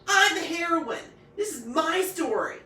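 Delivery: high, loud outburst; enraged. A narrator's reading. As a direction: insist furiously. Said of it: "red with rage".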